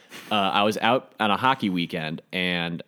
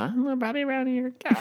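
put-on much higher childlike pitch; high-pitched kid voice. Shown as a demonstration. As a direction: show how high his sixth-grade voice was. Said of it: "do I have a high-pitched voice".

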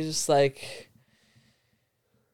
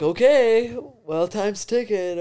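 higher-pitched mimicry, loud first word; lightly imitated impatient send-off, pitched up; tweaking stranger; 5 semitones higher, 4 dB louder than usual. Stranger about to leave in his Jeep, lightly mimicked nudge. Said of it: "this motherfucker's tweaking".

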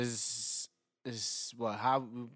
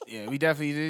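ordinary delivery

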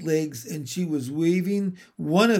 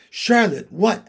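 raised, louder eager question; 5 semitones higher, 6 dB louder than usual. A narrator's reading. Pig asks the spider her surname, eagerly. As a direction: ask it keenly, voice lifted. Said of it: "asked Wilbur eagerly".

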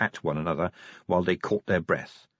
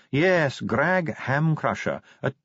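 unhurried, composed dwarf voice; Ardent, maddening calm; 6 semitones higher than usual. A narrator's reading. Dwarf answers the investigating commander about a death, enragingly calm. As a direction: stay infuriatingly unruffled, starting high and settling. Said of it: "with a calmness that was enraging".

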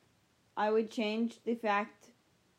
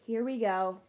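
normal talking voice

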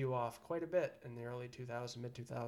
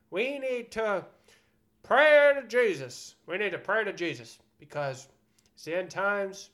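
mock doomsday preacher; 4 semitones higher, 9 dB louder than usual.

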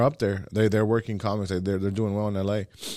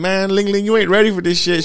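higher, louder boastful tease; raised pitch, cocky teasing; 8 semitones higher, 7 dB louder than usual. Retold or imitated. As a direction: brag at her playfully. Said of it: "I was like".

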